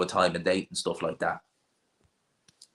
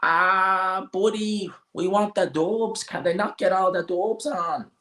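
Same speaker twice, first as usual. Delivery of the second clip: high, drawn-out Dublin whine; mocking Dublin accent; 11 semitones higher, slower than usual. Onlookers wanting only Dubs on, high mocking Dublin accent. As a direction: complain in an exaggerated high Dublin voice. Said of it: "They'd be like".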